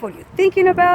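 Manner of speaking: high, loud, incredulous question; mocking quote. Quoting a condescending question.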